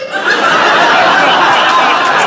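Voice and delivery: airy wheezing laugh; high breathless laugh. Real, not acted. Comedian cracking up at her own story, airy laugh.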